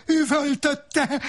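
slow, high-pitched yell; screamed storytelling; howled narration. A narrator's reading. Narration tag shouted along with quote.

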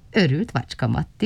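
plain reading voice

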